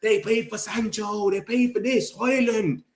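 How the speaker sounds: high-pitched mock complaining; mocking rival fans. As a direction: parrot their complaint in a silly high voice. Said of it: "They just talk about our old signings".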